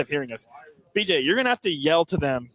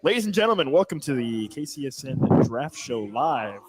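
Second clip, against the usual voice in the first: announcer; 2 semitones lower, slower than usual. Acted. Host opening the live show.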